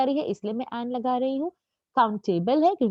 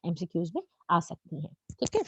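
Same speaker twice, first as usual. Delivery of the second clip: ordinary talk, nothing marked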